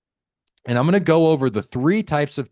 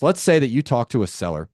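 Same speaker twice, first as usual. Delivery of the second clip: normal talking voice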